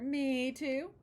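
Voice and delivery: loud, high keen chime-in; eager Papa. Acted. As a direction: jump in eagerly.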